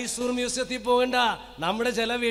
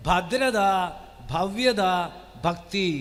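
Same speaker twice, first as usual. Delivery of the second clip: emphatic slow listing; 2 semitones lower, 4 dB louder, much slower than usual. Acted. Preacher repeats the three words.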